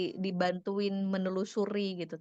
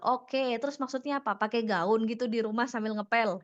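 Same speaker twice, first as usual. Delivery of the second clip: acted defensive questioning with a raised pitch; confrontational retort; 2 semitones higher, 3 dB louder than usual. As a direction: fire back as if challenged. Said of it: "Jadi langsung dikonfrontasi".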